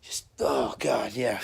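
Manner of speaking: put-on low, soft groaning as if drunk; mock tipsy groan, trailing down; acting out drunken stagger. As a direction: imitate your drunk self stumbling along. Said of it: "you know, like this".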